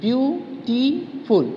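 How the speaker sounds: "Beautiful" slowly, view, टी, फुल; three separate beats on "beautiful"; syllable by syllable. Shown as a demonstration. Teacher repeating beautiful for students, slowly split in three. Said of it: "three syllables".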